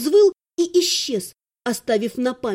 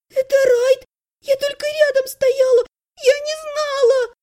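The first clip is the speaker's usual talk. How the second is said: very high, tearful whine; whimpering excuses; 12 semitones higher, 4 dB louder than usual. A narrator's reading. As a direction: plead innocence in a high whine. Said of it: "заскулила Элиса". (плаксиво оправдываться тонким голосом)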